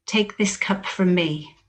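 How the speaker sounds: normal talking voice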